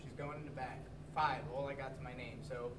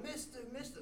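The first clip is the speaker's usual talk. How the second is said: mock yelling; 9 semitones higher than usual. Stranger coming down the hill toward the teller, mock yelling. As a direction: call out high, as if from afar. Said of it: "He's yelling".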